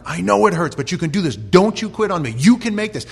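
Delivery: a coach shouting right in his worn-out player's face; coach yelling. Retold or imitated. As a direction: scream at him not to quit.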